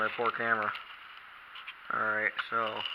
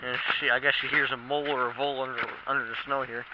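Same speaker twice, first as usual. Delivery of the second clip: ordinary delivery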